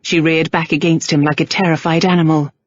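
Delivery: usual speaking voice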